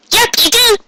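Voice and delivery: high lilting Yep, you do; playful singsong Pinkie; sing-song. Acted. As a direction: sing it lightly like a happy filly. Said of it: "something in a sing-song tone".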